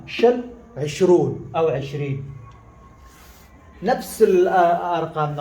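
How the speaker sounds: usual speaking voice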